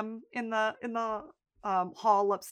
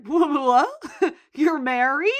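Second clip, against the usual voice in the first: loud, laughing disbelief; stunned, laughing what; shocked family; 5 semitones higher, 9 dB louder, much slower than usual. Retold or imitated. Family learning Fiona is married, laughing shock. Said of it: "the rest of the family's like".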